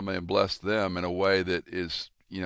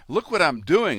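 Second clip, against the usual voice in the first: high, proud and louder; eager boast; 9 semitones higher, 6 dB louder than usual. Retold or imitated. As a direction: show off your plans with pride. Man showing blueprints to death.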